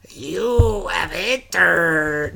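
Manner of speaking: slow ghostly whisper; breathy spooky ghost hiss; unearthly voice. Retold or imitated. Ghost voice in movie recap.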